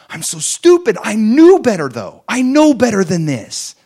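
high, loud, acted exasperation; frustrated self-reproach. Saved believer who sinned again, frustrated self-reproach. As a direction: berate yourself, pitch climbing high. Acting out believer berating himself.

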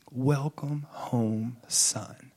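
very slow, hushed and tender; tender welcome. Retold or imitated. Parents' sign to the returning son, tender welcome. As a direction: let each word land softly, falling away.

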